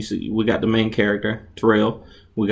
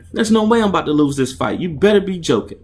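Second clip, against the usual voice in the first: angry refusal; 8 semitones higher than usual. Acted. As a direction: refuse him with outrage. Angry refusal to throw fight.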